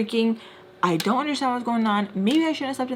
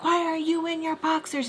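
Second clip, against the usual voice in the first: accusing wife; 8 semitones higher than usual. Retold or imitated. Retold wife confronting her husband.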